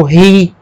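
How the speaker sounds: ri with an English H before the i; strong aspiration. Shown as a demonstration. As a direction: push air into ri, sounding like hee. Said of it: "com aspiração forte".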